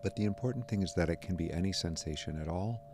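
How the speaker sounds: soft, unhurried meditation voice with long pauses; guided meditation. Real, not acted. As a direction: calmly guide their attention to the breath. Guides attention to breath sensations.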